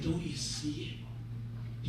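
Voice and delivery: low, soft, slow question; gentle revelation. Acted. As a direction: ask it quietly, like a wise rabbi. Wise rabbi gently reveals truth.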